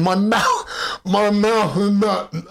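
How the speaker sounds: "mouth" slurred through numb, frozen lips. Retold or imitated. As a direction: drag out "mouth" as if the lips won't move. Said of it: "I was talking like that".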